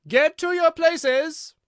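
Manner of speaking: loud, high, drawn-out bellow; slow royal shout at full force; voice of thunder. A narrator's reading. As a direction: thunder the order to a crowd. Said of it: "in a voice of thunder".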